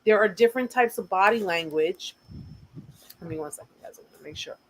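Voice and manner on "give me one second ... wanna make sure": much softer, half-whispered aside; muttered aside. Real, not acted. A quick off-mic aside to viewers, much softer. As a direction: drop almost to a whisper to check something.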